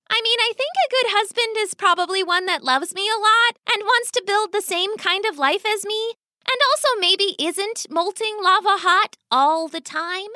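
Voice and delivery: put-on high young daughter voice, earnest; reasoning daughter. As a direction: argue sensibly in a high girlish pitch.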